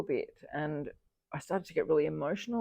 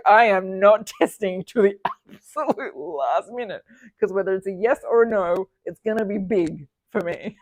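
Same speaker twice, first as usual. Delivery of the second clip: 1 playful firm refusal; 8 dB louder than usual. Retold or imitated. Retold firm refusal to test.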